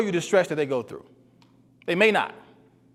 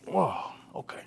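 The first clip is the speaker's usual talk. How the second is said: mock relieved whisper; 7 dB quieter than usual. Acting out relief after crying.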